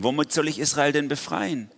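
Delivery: acted loud objection; calling out. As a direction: cry out the doubt as Gideon. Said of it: "rief Gideon".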